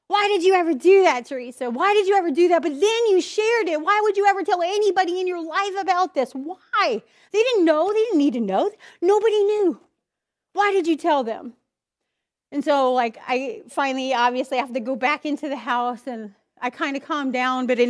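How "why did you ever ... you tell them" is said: berating herself, higher and louder; self-scolding. Retold or imitated. Woman beating herself up over a confession, angry and high. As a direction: scold yourself for sharing too much. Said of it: "Beating myself up".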